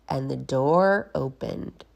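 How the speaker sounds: ordinary delivery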